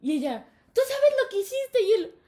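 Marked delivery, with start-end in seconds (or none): 0.7-1.8 high, loud accusing question; imitating an indignant teacher; acted scolding. Teacher confronting the cheater, loud mock scolding.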